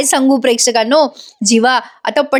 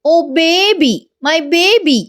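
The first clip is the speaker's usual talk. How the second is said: put-on higher, coaxing baby talk to his wife; sweet-talking husband; 6 semitones higher, much slower than usual. Vijay coaxing his wife Ramya, high and sweet. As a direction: coo it sweetly, pitched up. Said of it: "विजय आता तिच्या चेहऱ्यावरनं हात फिरवत म्हणतो".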